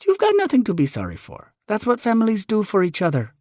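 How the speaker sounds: high, loud motherly voice. Acted. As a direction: mom reassuring simply. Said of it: "Mom says simply".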